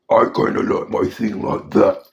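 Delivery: put-on gruff monster voice; gruff Thing voice. Shown as a demonstration. Fan showing the voice he wants for the Thing, gruff. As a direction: growl it out roughly like a rock brute. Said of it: "they want back the gruff voice of the thing".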